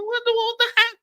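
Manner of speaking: squeaky, high and hurt; mock-offended high voice; high indignant squeak. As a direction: protest in a high wounded voice. His indignant reply.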